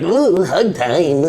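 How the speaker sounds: slow, high creepy clown croon; giggly sinister sing-song. Acted. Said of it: "creepy clown laugh coming out of that".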